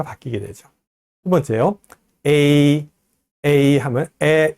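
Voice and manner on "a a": A drawn out, 에 gliding into 이; two vowels merged. Shown as a demonstration. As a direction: hold A and let it move to ee. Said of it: "이 두 개가 합쳐서 A".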